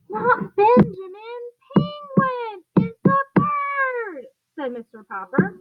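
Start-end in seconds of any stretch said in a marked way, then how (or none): 0.1-4.3 exasperated correction. Acted.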